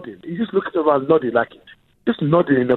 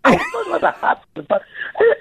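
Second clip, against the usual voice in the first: indignant surprise; 12 semitones higher than usual. Real, not acted.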